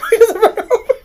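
loud, high laughing words. Real, not acted. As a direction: burst out laughing as you read. Bursts out laughing while reading.

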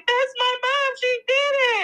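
put-on very high, proud kid cheer; imitated child cheering. Mother mimicking her child at her graduation, high proud cheer. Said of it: "and she was like".

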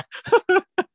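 a sudden high-pitched squeal of laughter. Real, not acted. Host squeals with laughter at her own joke. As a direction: burst into a shrill giggle.

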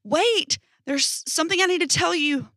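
a friend shouting after her, pitch way up; crying out. A narrator's reading. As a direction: call after her urgently, voice jumping up. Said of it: "Arjun crying out".

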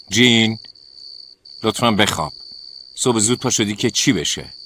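tired roommate begging her to stop; irritated plea. Acted. Annoyed roommate asking to stop. (درخواست کلافه و بلند)

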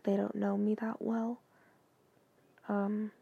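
ordinary talk, nothing marked

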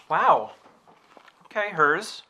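ordinary talk

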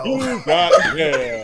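slow high announcer imitation; AOL voice. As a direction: draw out each word, mock computer greeting. AOL dial-up joke.

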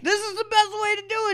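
put-on high excited kid. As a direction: insist like a proud kid.

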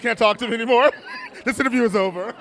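mock huffy, laughing, fast and loud; laughing mock outrage. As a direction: fake storm-off through laughter. Mock-ending interview over Game of Thrones.

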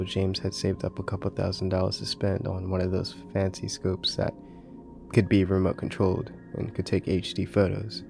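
plain reading voice

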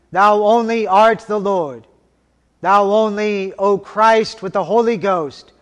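normal talking voice

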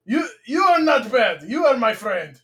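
deliberate broken-English Russian voice; Russian accent. Retold or imitated. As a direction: imitate a Russian declaring friendship. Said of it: "You speak good broken English".

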